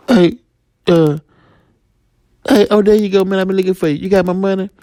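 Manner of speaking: corner him about the money, pitched high; dealer. Acted. Dealer finds the debtor.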